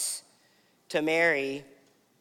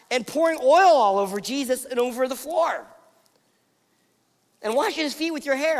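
higher-pitched, incredulous complaint; raised voice, piling up objections; baffled protest; 5 semitones higher than usual. Acted. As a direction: act out an affronted dinner guest demanding answers. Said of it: "everyone in the scene ... is, is asking the question".

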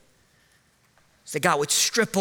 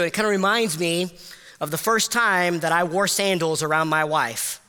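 ordinary delivery

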